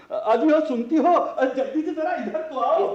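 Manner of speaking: father excitedly calls his wife to come hear the news; calling out. Acted.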